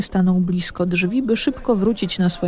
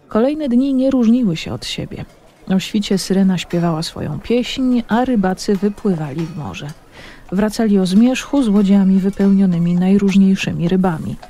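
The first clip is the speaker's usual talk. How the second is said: ordinary delivery, nothing marked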